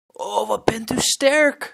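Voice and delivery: put-on high, slow awed child voice; admiring little boy. Rescued boy admires the pirate, high awed child voice. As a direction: marvel at how strong he is. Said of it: "zegt Frank".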